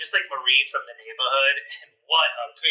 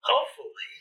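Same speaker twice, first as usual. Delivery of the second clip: high incredulous whisper; playful disbelief; mock outrage; 11 semitones higher, 3 dB quieter than usual. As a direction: act scandalized at the word. Dad mock-offended.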